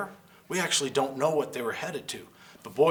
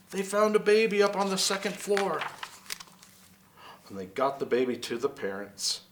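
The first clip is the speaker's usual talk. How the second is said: ordinary delivery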